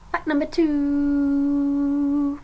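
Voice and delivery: announcer voice. Pretend. Dramatic announcing of fact.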